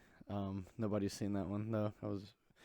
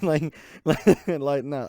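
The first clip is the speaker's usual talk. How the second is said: laughing; 4 semitones higher, 14 dB louder, much slower than usual. Real, not acted. Laughing off her own bit.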